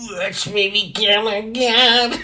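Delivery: high, slow mock-godlike voice. Vlogger mocking a guitar string ad, high slow sneering voice. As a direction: ham up the ad line in a high sneer. Mocking [name]'s ad line.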